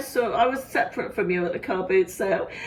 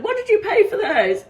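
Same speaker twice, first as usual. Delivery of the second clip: surprised; 11 semitones higher, 5 dB louder than usual. Retold or imitated. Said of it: "And I said".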